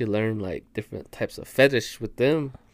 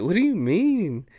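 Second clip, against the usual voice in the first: mildly incredulous, pitched up; puzzled question; 8 semitones higher than usual. Retold or imitated. Man retelling his reply to coworker's intimate talk, puzzled. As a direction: ask it higher, acting out mild bewilderment.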